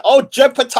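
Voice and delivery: high, loud, long-held name; excited shout-out. Real, not acted. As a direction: holler the name with a stretched ending. Greeting a viewer by name.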